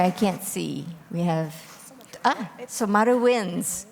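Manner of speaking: ordinary talk, nothing marked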